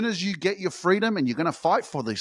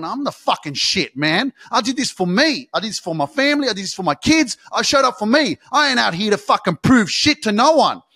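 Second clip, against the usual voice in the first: proud self-talk; 5 semitones higher, 6 dB louder than usual. Retold or imitated. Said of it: "looking at yourself in the mirror and going".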